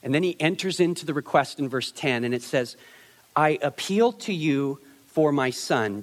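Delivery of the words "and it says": usual speaking voice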